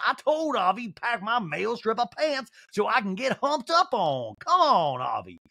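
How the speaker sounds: slow Southern drawl climbing high; mock Southern rant. Housewife furious at assistant over missing pants, mock Southern drawl. Mimics Sutton ranting about pants.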